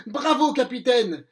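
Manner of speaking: pirate ravi qui acclame, plus fort; exclamation joyeuse. Acted. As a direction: acclamer le capitaine. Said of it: "s'exclame Mouche". (Mouche acclame son capitaine, tout joyeux)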